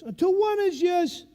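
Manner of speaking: put-on loud, high South Boston accent over a megaphone; South Boston instructor. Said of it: "he talks like this 'cause he's from South Boston".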